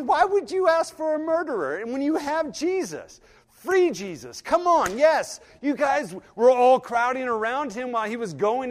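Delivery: loud, high preaching voice; fervent preaching. Acted. Preacher challenging his congregation, loud and indignant. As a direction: press the question hard on the congregation.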